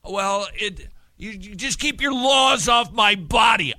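hammed-up protester shouting; mock shouted slogan. Mocking pro-choice slogan.

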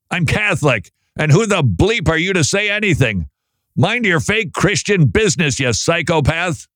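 high-pitched mock indignation; indignant email writer. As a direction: read hate mail in a high, hammed-up voice. Reads out an abusive listener email.

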